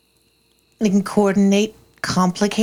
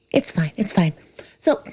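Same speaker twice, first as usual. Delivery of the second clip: normal talking voice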